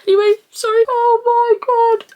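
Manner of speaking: loud, high tearful wail; crying. Real, not acted. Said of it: "I'm gonna start crying".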